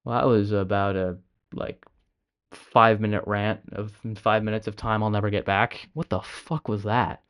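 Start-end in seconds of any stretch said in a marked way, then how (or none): none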